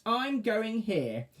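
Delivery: high, very slow witch. Acted. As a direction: drag it out like a witch.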